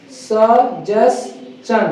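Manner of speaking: "Suggestion" very slow as sə... jes... chən; syllable by syllable. Shown as a demonstration. Teacher drilling students, "suggestion" broken slowly into three syllables. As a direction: pause between each syllable of "suggestion". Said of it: "इसको बोलेंगे क्या?".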